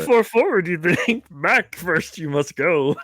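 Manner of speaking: Yoda voice. Acted. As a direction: croak it out like wise old Yoda. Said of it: "You have to do the voice".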